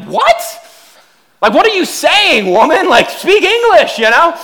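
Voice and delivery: high, loud, baffled disbelief; mock outrage at a strange word; baffled outburst. Husband balking at his wife's odd request, high mock disbelief. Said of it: "I'm just like".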